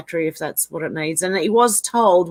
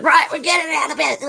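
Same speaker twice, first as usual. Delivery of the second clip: high-pitched, hammed-up complaining Karen; mock Karen; 7 semitones higher than usual. Streamer pretends to ring car company, mock Karen outrage. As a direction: play an indignant customer on the phone. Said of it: "if we acted like Karens and rang them".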